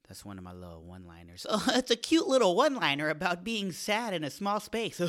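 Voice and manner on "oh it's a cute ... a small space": high, loud, laughing put-on voice; silly put-on voice. Comic scolds himself for a goofy high, loud voice. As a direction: cackle through it in a goofy high voice. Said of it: "Don't do that voice".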